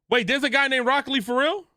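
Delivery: genuinely thrown by the name; surprised disbelief. Real, not acted. Streamer reacting to Rock Lee being real, surprised and high.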